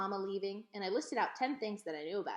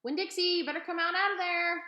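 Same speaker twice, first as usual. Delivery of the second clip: high, loud holler; high shouted call to the dog; hollering at the gate; 9 semitones higher, 7 dB louder than usual. A narrator's reading. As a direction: shout into the overgrown yard.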